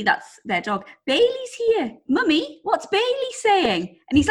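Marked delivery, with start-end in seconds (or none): 1.1-4.0 put-on high, slow, eager little boy; a toddler thrilled to see the dog; excited child voice. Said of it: "And then Henry said".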